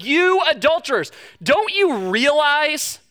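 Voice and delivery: accusing rebuke. Retold or imitated. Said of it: "coming in guns a-blazing".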